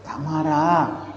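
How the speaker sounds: slow, soft calm reply; calmly unbothered; calm not angry. Shown as a demonstration. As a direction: say it gently, slowly, with no anger at all. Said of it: "tak adalah marah langsung".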